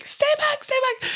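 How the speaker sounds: very high, squeaked warning; mock panicked warning. Acting Doctor warning from cart.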